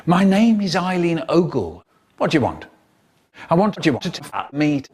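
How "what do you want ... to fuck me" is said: usual speaking voice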